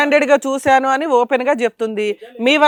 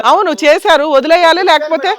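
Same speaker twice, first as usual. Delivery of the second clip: loud, higher-pitched comeback; angry retort; 4 semitones higher, 6 dB louder than usual. Real, not acted. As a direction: cut down his excuse with raised voice. Host snaps back at husband's excuse. (కోపంగా గట్టిగా తిప్పికొడుతూ)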